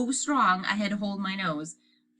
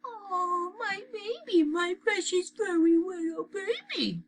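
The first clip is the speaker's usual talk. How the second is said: put-on high, slow baby talk to a puppy; cooing; 14 semitones higher, slower than usual. A narrator's reading. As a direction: coo over a beloved puppy. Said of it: "she cooed".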